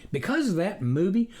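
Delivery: plain reading voice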